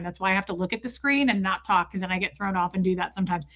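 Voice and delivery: ordinary talk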